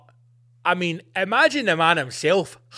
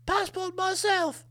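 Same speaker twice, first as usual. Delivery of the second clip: put-on a frail, hushed old queen, much higher and softer; 13 semitones higher, 7 dB quieter than usual. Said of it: "then she comes shuffling in".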